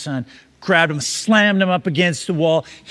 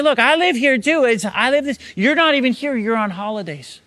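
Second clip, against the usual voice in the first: high-pitched, mildly indignant retort; protesting; 6 semitones higher than usual. Retold or imitated. Buddy defending himself to the absent homeowner, protesting. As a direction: argue back in a raised pitch. Buddy argues back in retold story.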